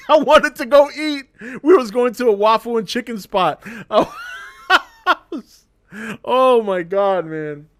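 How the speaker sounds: loud, laughing recollection; can't hold the laughter in; laughing while telling. Real, not acted. Laughing recalling the fight aftermath.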